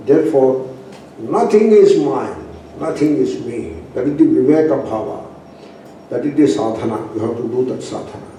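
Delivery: normal talking voice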